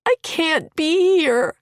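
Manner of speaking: high, slow and loud, near tears; tearful plea. Acted. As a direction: plead through tears.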